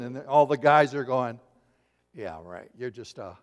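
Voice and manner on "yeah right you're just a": low, soft scoff; dismissive men. Retold or imitated. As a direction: brush it off under your voice, much lower. Men dismiss the women's report.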